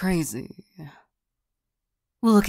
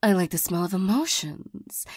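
ordinary talk

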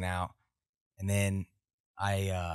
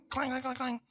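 imitated bottle clangs.

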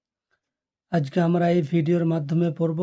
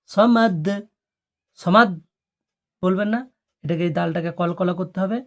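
normal talking voice

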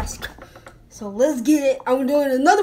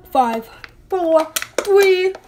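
excited countdown; 3 semitones higher, 4 dB louder, much slower than usual. Real, not acted. Counting down to drink challenge.

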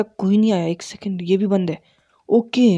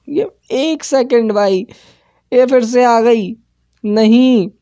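alarmed dismay; 5 semitones higher, 4 dB louder, slower than usual. Real, not acted. Nun returns again.

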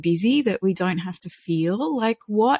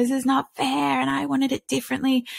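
higher mock child whine, complaining; whiny upset child; 6 semitones higher than usual. Mother imitating her upset child, a higher mock whine. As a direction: play the kid protesting on the floor.

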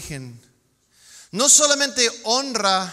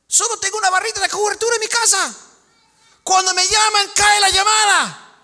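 high, fast whiny complaint; hammed-up petulant complainer, much higher and louder; mock whining; 11 semitones higher, 6 dB louder than usual. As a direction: whine like a spoiled kid about bad signal. Mocking spoiled first-world complaint.

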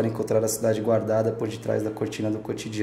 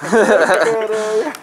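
amazed; 8 semitones higher, 6 dB louder, much slower than usual. Real, not acted. Amazed at friend's trick.

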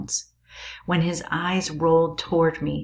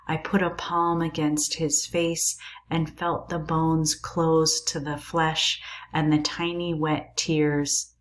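plain reading voice

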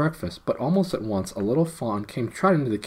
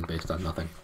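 plain reading voice

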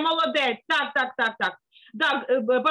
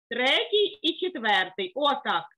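ordinary talk

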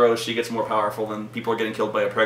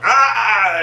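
high, loud imitated scream; mock scream; 8 semitones higher, 5 dB louder than usual. Alien-strong woman breaking from two doctors, mock scream. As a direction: let out a hammed-up scream.

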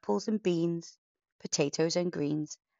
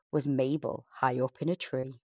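ordinary delivery

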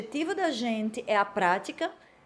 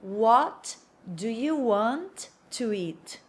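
slow, word-by-word "What do you want to eat?"; more slowly; much slower than usual. Shown as a demonstration. Teacher slowing the question down for learners, slow and separated. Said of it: "Agora eu vou falar mais devagar".

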